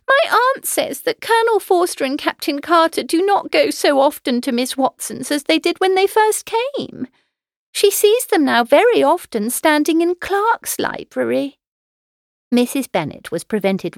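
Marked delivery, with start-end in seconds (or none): 0.1-11.6 put-on high, excited young daughter's voice; Lydia. A narrator's reading. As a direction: cry it out eagerly, pitched right up. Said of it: "cried Lydia".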